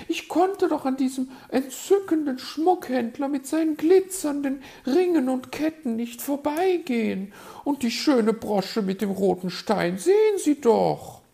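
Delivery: very high, loud bird lady; magpie, defensive and gushing. A narrator's reading. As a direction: gush in a high put-on voice.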